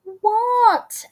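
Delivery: put-on high, loud child's disbelief; incredulous little kid's high query. A narrator's reading. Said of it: "Ada queried".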